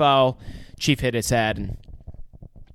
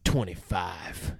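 low, quiet and slow; low slow gruff; 8 semitones lower, 9 dB quieter, much slower than usual. Retold or imitated. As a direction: drop it low and deadpan. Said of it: "Quint says".

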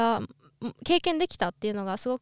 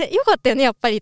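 excited; 9 dB louder, much faster than usual. Retold or imitated. Gleefully recalling the success.

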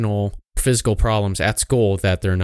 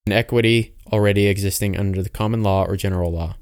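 ordinary talk

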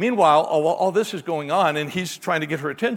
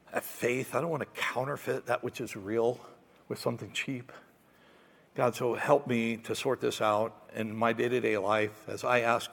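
softer, lower, earnest prayer voice; closing prayer; 5 semitones lower, 6 dB quieter than usual. Acted. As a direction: pray quietly to close the sermon.

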